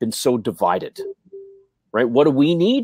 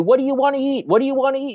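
mock impatient yell; 11 semitones higher, faster than usual. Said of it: "they're yelling at you".

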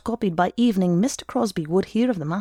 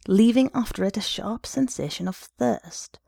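ordinary delivery

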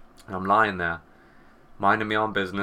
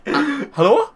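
startled; 7 dB louder, slower than usual. Retold or imitated. Startled answering the device.

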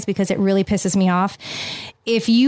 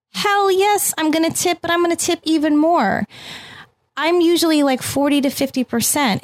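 excited; 7 semitones higher than usual. Real, not acted. Passionate about overtipping.

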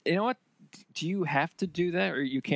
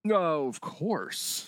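very drawn-out, higher-pitched sarcasm; sarcastic; 4 semitones higher, much slower than usual. Real, not acted. Friend answering a tip about buying on Amazon, sarcastic. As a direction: stretch it slowly into mock agreement. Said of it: "Was that sarcasm?".

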